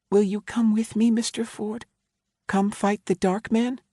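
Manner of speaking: put-on high, small child's voice; a frightened five-year-old admitting fear; scared little girl. A narrator's reading.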